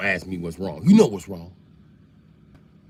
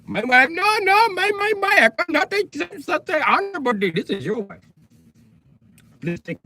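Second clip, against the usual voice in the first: put-on Indian accent pitched way up, flustered and polite; high Indian accent; 9 semitones higher than usual. Indian husband refusing the offered wife, high and flustered Indian accent.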